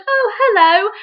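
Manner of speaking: hammed-up star greeting a fan; mock polite celebrity. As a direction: play the bored star being nice. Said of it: "they're just like".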